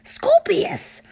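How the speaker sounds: high, loud shout of his name; yelling. A narrator's reading. Father silences his babbling son, yelling. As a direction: yell the name in outrage. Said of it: "Mister Draco yelled".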